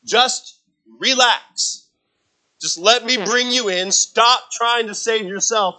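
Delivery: high, loud, slow lifeguard call. Retold or imitated. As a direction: call out to a drowning swimmer to relax. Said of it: "they say to that person is".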